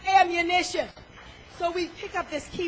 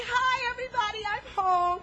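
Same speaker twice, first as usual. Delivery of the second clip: cheerful greeting; 6 semitones higher than usual. Retold or imitated. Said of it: "And I'm gonna say".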